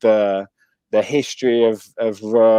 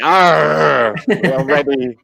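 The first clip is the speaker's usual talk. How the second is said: higher, louder hammed-up pirate growl; 5 semitones higher, 4 dB louder, much slower than usual. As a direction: ham it up like a cartoon pirate. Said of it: "well, a bit more piratey".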